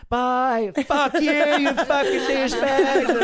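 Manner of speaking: put-on high, loud, slow dragged-out drunk yell; drunken yelling. Drunk woman yelling at guys as friend drags her out. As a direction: stretch the curse out like a furious drunk.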